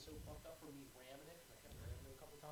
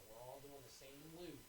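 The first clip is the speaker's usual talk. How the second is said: usual speaking voice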